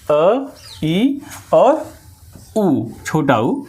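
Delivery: slow dictation of vowels; slow, drawn-out letters. Shown as a demonstration. Teacher dictating vowel names slowly to students. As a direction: stretch each vowel for the class. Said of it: "इसके अंतर्गत अ".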